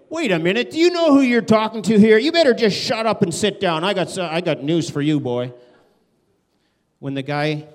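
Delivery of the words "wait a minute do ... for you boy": loud, high, domineering put-down; angry rebuke. Retold or imitated. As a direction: bark it like an offended boss. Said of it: "He didn't say".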